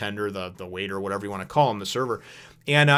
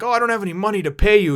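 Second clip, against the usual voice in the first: put-on pleading old man; 9 semitones higher, 6 dB louder, faster than usual. Old man voiced asking for help.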